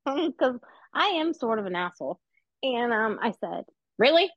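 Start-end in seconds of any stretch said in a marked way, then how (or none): 4.0-4.3 higher, louder indignant "Really?"; incredulous really. Retold or imitated. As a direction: call her out with disbelief. Retold reply to rude stall neighbour.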